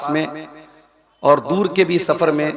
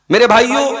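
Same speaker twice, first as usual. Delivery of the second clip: raised, higher call to the brothers; 6 semitones higher, 5 dB louder than usual. Real, not acted. Preacher calls out to his brothers, loud and higher. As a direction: open the point with a loud rallying call.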